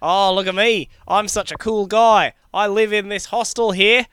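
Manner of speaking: Australian accent, exaggerated; mock Aussie backpacker. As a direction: play the lazy Aussie charmer. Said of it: "but I talk like this".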